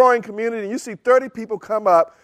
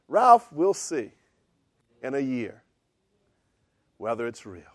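understated and unhurried, dropping softer with each phrase; calm; 6 semitones lower, 4 dB quieter than usual. Retold or imitated. Calm group leader answering an excited young believer, measured and low. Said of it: "He was real kind of calm".

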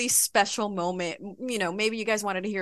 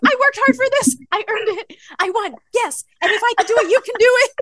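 much higher and louder victory cry; ecstatic cheer; 8 dB louder, faster than usual. Retold or imitated. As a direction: shout it like the winner on stage. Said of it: "I was like".

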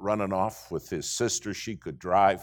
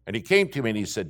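usual speaking voice

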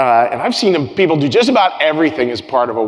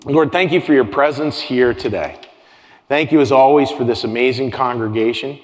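pastoral prayer. Acted. Pastor praying for the congregation in calm prayer. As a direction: pray it unhurried and sincere.

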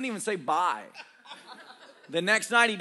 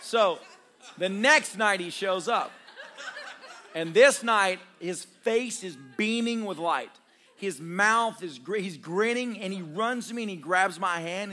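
ordinary delivery

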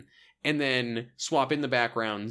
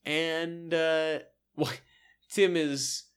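ordinary talk

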